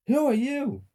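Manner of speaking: very high, loud, startled demand; high-pitched alarmed child; alarmed question. A narrator's reading. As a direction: pitch way up, shocked at a stranger. Child alarmed by unknown figure.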